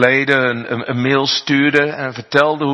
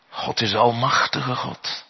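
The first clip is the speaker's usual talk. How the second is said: ordinary talk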